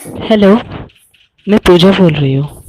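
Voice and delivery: put-on loud girly voice on the phone; man doing a woman's voice. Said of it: "लड़की की आवाज़ निकाली थी".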